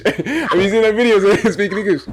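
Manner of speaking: normal talking voice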